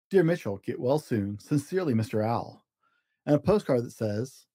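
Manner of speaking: gentle storybook narration with small character voices; reading to children. A narrator's reading. Dad reading a story to his kids, storybook voice. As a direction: read it like a bedtime picture book. Dad reads picture book aloud.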